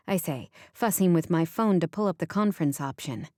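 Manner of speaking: usual speaking voice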